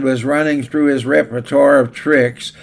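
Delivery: normal talking voice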